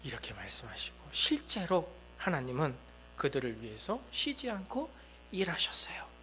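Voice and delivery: ordinary talk, nothing marked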